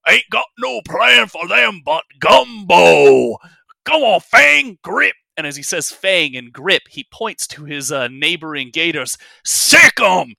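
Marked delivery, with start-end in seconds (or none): 0.1-5.1 much higher, much louder slow roaring snarl; bugbear snarl. Acted. Bugbear snarling back at his commander, calling the gators. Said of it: "immediately snarl out". 9.4-10.3 much higher, much louder barked order; bugbear command. Acted. Bugbear ordering his crocodiles to attack the party.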